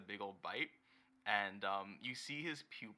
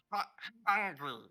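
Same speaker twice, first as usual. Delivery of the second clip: goblin voice; 9 semitones higher, 7 dB louder than usual. Acted. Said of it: "He says, uh".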